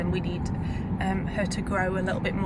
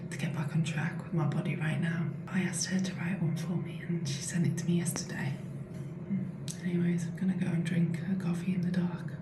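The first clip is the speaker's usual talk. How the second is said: whispering while hiding; 6 dB quieter than usual. Real, not acted. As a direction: whisper softly in the bathroom. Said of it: "I'm whispering".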